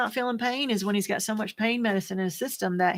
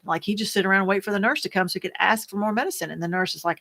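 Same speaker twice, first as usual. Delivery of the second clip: usual speaking voice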